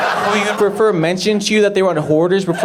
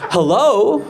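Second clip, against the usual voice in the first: higher questioning hello; hoarder answering phone; 7 semitones higher than usual. Acted. Hoarder woman answering her phone, higher put-on voice. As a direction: pick up the phone in an odd, raised voice. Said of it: "And she was like".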